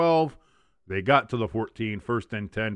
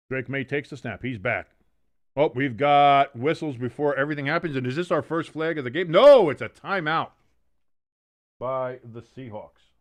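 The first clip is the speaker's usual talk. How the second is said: play-by-play; 2 semitones higher, 6 dB louder than usual. Acted. Play-by-play call of the game.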